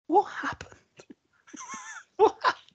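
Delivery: high, breathless whispered question through laughter; laughing whisper to the passed-out wife; laughing disbelief. Retold or imitated. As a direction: ask it while cracking up. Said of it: "And I'm like".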